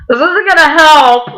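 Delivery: high, loud mock whine; hammed-up tearful wail; kinda crying. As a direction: whine it through pretend tears. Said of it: "like, kinda crying still".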